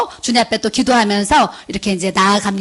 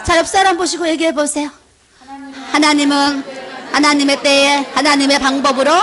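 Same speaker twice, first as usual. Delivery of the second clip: ordinary talk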